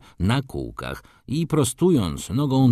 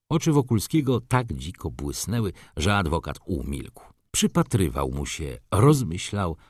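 plain reading voice

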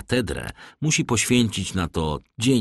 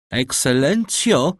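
drawn-out, higher-pitched hail; calling out; 7 semitones higher, 3 dB louder, slower than usual. A narrator's reading. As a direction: call up to a window, stretching the word. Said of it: "zawołał Cicerone". (wołając, przeciągle)

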